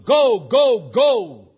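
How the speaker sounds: mimicking preachers stressing go; mock emphasis on go. Shown as a demonstration. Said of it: "As if go is really the imperative verb".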